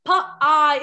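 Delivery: "P, I" split into two separate, punchy sounds; segmented sounds. Shown as a demonstration. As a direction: say "P" then "I" as distinct phonics sounds. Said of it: "It's just two sounds".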